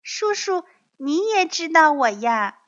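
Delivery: put-on high, curious child voice; innocent baby dinosaur. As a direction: ask uncle brightly in a little one's high voice. Baby innocently greets the predator.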